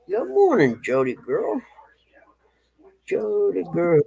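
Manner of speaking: high, bright-eyed hello to a pet; sing-song dog greeting. Real, not acted. Owner greeting the dog Marks in the yard, high sing-song pet talk.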